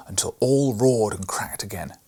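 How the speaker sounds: ordinary delivery, nothing marked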